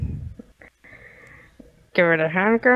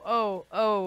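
usual speaking voice

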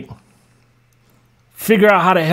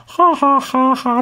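hammed-up fake ha ha, pitched up; mock side laugh; 5 semitones higher, slower than usual. Mocking the commenter's laugh emojis with fake ha ha. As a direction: fake a taunting typed laugh. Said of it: "do the side laugh".

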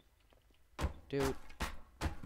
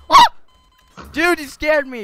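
high startled yell; real frightened shout; scared scream; 10 semitones higher, 13 dB louder than usual. Real, not acted. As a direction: shout it after a jump scare. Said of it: "sorry for my screaming".

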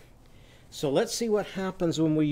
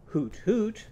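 higher-pitched owl hooting; 6 semitones higher than usual. Shown as a demonstration. Teacher showing children what owls say, higher owl hoot. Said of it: "What do owls say?".

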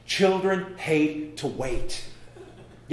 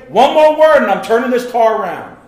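loud parent warning, much louder; stern parent threat; 5 semitones higher, 12 dB louder than usual. Retold or imitated. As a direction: threaten the kids from the driver's seat. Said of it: "what do the parents say".

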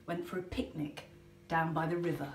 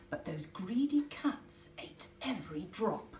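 plain reading voice

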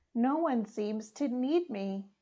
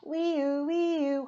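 high siren wee-oo; siren wail; 4 semitones higher than usual. Acted. Little truck on the way, siren call.

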